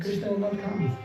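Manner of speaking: ordinary delivery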